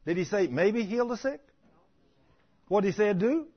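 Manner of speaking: usual speaking voice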